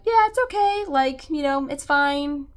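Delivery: high, loud, breezy brush-off; imitating a parent shrugging it all off as fine; airy mock it's-fine voice; mock nonchalance. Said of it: "where you're like".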